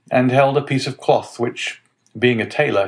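ordinary delivery, nothing marked

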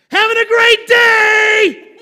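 booming all caps cheer; all caps shout. Retold or imitated. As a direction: shout it like a post typed in caps. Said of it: "That's when it's all caps".

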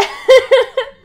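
loud high laugh; burst of laughter. Real, not acted. Host cracking up at her own joke, loud laughing.

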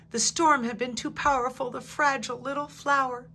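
ordinary delivery

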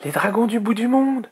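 put-on high-pitched young dragon amazement; high, wonder-struck cry; amazed exclamation. A narrator's reading. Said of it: "s'exclama Azuro".

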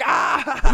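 high, loud mock yell; re-enacted scream under blows; retold yell. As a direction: yell as if being hit. Acts out reaction to being hit.

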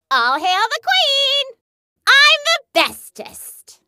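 high, triumphant proclamation; gleeful self-crowning. Acted. Crowning herself queen.

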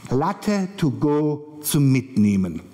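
slow, deliberate vendor's offer; coffee hawker. Acted. Coffee seller answering what he has on offer, slow hawker voice. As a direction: offer it slowly, each word set apart. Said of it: "Und dann sagt er zu mir".

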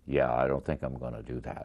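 low, soft, underplayed imitation of a film actor; hushed low-register star talk; barely audible movie star. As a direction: mumble it low like a screen star. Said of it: "it's hardly audible".

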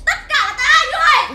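screaming blame at them, voice cracking high; furious accusing scream. Acted. Grieving wife screams blame at husband and mother-in-law. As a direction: hurl the blame with everything.

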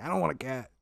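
lightly voiced grumbling dad; a hint of a stubborn old man refusing; dad refusing the cat, lightly voiced grumble; grumpy dad. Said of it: "And he's like".

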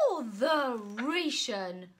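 loud, slow booming knight. Acted. Knight booming over his lost dragon, loud and slow. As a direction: boom it out in frustration. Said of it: "boomed the knight".

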